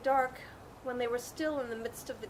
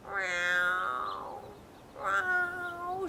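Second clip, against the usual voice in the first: a sad, pleading cat meow; plaintive meow; 7 semitones higher than usual. Acted. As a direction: mew like a forlorn cat begging for help. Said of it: "she called in the most plaintive kind of voice".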